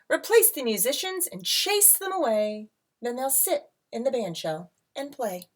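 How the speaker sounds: lilting read-aloud storybook voice; rhyming picture book read to a class; reading to children. A narrator's reading.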